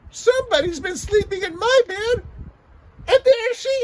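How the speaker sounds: put-on very high, loud, wailing baby bear; tiny crying baby bear. Baby bear crying to his parents, high and wailing. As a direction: wail it like a heartbroken little cub. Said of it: "Baby Bear said".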